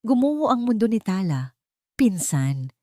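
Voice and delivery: ordinary delivery, nothing marked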